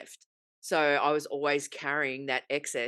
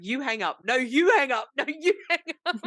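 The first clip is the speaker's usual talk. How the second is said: teasing singsong, rising and laughing; mock lovers' hang-up game; childish back-and-forth, laughing higher each time; playful laughing; 8 semitones higher, 3 dB louder than usual.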